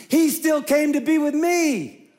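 high, slow, emphatic; soaring preacher emphasis; emphatic rise. Real, not acted. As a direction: lift it high and slow with conviction. Climax of sermon point.

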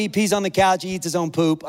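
ordinary talk, nothing marked

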